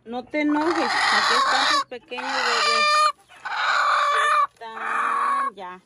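high, loud, nervous pleading to the hen; anxious pleading. Real, not acted. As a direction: plead while the hen pecks.

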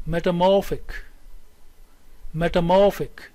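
"metamorphic" with main stress on "mor", lighter on "met"; primary and secondary stress. Shown as a demonstration. Teacher reads the next word for students, stressing its third syllable. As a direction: model "metamorphic", punching the "mor". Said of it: "Here we have primary stress".

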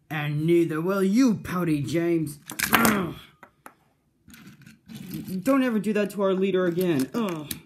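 loud, high villain voice mid-fight; villain taunt. Acted. Villain Sir Trumpet Hat fighting James, loud taunting voice. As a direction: snarl the taunt then grunt from the hits.

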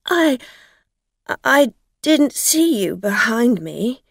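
loud, high, halting protest; flustered excuse. A narrator's reading. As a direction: stammer in your own defence. Frightened driver excuses herself.

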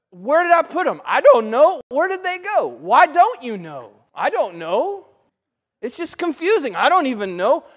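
high, loud, baffled back-and-forth; mock bewilderment. Someone who lost their keys, exasperated confusion. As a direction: act out flustered questions and helpless answers.

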